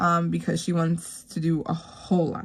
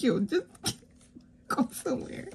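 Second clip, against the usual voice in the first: laughing; 6 semitones higher, 5 dB quieter than usual. Real, not acted. Laughing at her son's offer.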